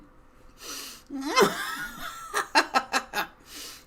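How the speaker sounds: high shrieking witch cackle; hag cackle. Acted. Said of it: "The hag cackles".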